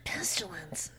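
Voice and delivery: soft whispered name. Retold or imitated. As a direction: breathe the name out. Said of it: "and then you say".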